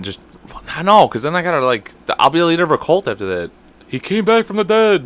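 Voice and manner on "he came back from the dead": high, drawn-out mock amazement; mock amazed follower. Joking as his own cult follower, mock amazed. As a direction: play a follower marvelling at a miracle.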